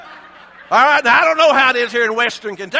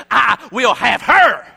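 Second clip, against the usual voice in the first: Herod's shouted claim; 7 dB louder, slower than usual. Retold or imitated. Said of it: "And Herod Antipas said".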